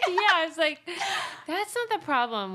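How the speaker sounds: loud laughing reply. Real, not acted. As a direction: laugh while you say it. Laughing retelling.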